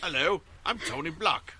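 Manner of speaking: high, loud mock British accent; British accent impression asked for by the co-host. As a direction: put on a British accent to play the host Tony. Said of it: "Should I have done that in a British accent?".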